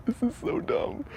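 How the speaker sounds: tiny, very high-pitched squeak; nervous squeal. Real, not acted. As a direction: squeak it out as nerves take over. Scared, nearing the noisy building.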